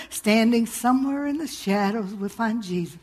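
soft, mocking hymn line; half-sung hymn line, soft and dismissive; mock hymn quote. Mocking a hymn to the congregation, soft. Quoting a disliked hymn softly.

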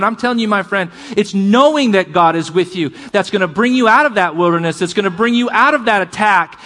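normal talking voice

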